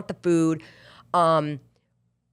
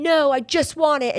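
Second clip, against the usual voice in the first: higher, whining voice of someone making excuses; mock whiny excuse; 9 semitones higher than usual. Doubter whining back at the coach, higher excuse voice. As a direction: whine it like a doubter. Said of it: "people out there are gonna be like".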